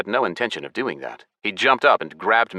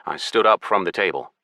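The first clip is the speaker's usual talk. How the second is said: ordinary delivery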